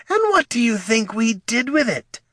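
sharp annoyed snap; snapping. Acted. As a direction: snap the question back. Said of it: "snapped Baggs".

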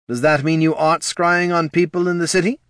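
lightly voiced official asking pointedly; questioning official. A narrator's reading. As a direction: put the question up high.

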